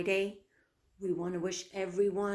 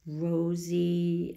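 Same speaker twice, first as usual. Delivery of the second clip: ordinary delivery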